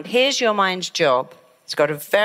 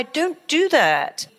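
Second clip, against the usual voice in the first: ordinary talk, nothing marked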